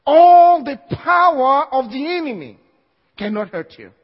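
loud, high preaching cry. Acted. Preacher declaring to the church, loud and high. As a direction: hammer it home.

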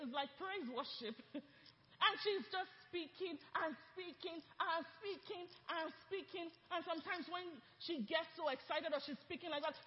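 ordinary delivery

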